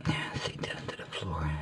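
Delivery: hushed whisper while working. Real, not acted. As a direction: whisper it to yourself. Quietly checking the cut.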